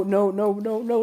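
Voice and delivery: ordinary delivery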